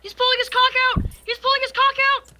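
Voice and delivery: high, loud scream in fast bursts. Pretend. Gamer derailing a friend's Twitch stream, screaming. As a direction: scream it at the top of your voice, very high. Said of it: "you do not need to scream that".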